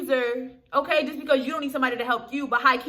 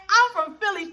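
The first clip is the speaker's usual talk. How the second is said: lightly mocked Philly accent, higher; 7 semitones higher than usual. Retelling Kelly claiming her city, mock Philly accent. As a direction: imitate her Philly accent at a raised pitch. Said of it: "That Philly accent straight turned on".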